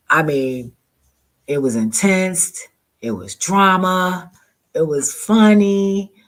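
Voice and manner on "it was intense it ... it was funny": each line higher and louder, slowing down; building excitement. Real, not acted. Summing up the wild day, rising excitement. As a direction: climb with each word.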